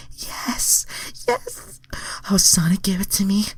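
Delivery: high, breathy whispered squeals; squealing Starfire. A narrator's reading. Narrator reading the heroine's climax, high whisper. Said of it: "Starfire squealed".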